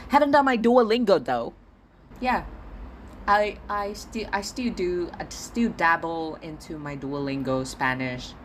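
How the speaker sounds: ordinary talk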